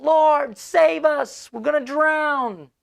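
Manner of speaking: high frantic cry for help; panicked disciples screaming. Retold or imitated. Disciples in the storm beg Jesus, panicked high shout. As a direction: shout it high and scared, sure you'll drown. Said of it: "they're screaming and they're yelling".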